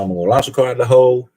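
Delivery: quick mimicry with "hole" turned into "hoe"; imitating Ken. Streamer quoting a friend about launching the car, "hole" as "hoe". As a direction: drop the L so "hole" comes out "hoe". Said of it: "that sounds more like hoe".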